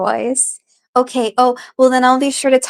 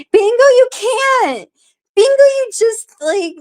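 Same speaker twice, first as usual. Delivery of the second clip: exasperated outburst; 11 semitones higher than usual. Real, not acted. Streamer ranting about bingo to chat, exasperated high outburst. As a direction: blurt it out in high-pitched frustration.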